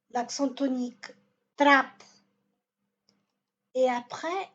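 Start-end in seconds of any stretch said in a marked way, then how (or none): 1.6-2.0 trappe louder, punched first vowel, tiny ending; stress on TRA. Shown as a demonstration. Teacher models trapo, stress on first syllable. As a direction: hit TRA hard in trappe. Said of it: "sur la avant-dernière syllabe".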